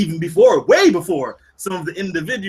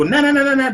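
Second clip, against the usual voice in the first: high-pitched taunting nonsense syllables; mocking "na-na" imitation; 11 semitones higher, faster than usual. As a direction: imitate an annoying little jeer. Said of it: "the ones that go".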